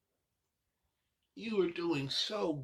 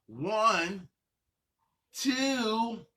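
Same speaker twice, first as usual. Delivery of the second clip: building count-in; 4 semitones higher, 6 dB louder, much slower than usual. Real, not acted. Instructor counts the dancers in, growing louder and higher. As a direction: count rising higher and louder to a big three.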